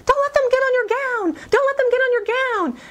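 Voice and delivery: put-on high, urgent warning to a child; a mother crying out in alarm, pitched high; frantic mother. Mother warning her little girl about mice, high and frantic. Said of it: "her mother is saying".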